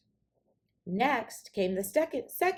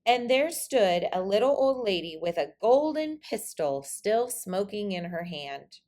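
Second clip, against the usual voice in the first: usual speaking voice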